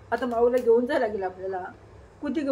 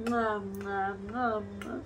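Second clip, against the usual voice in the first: soft kisses; 4 semitones lower, 9 dB quieter, slower than usual. Real, not acted. Kissing her daughter.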